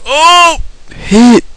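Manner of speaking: high-pitched game yelps; excited yelling. Real, not acted. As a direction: yell for the hit. Excited yelling during fight.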